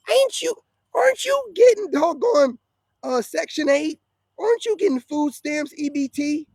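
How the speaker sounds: a high, scornful interrogation; mocking challenge. Real, not acted. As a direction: needle them with each question. Mocking rhetorical questions to women.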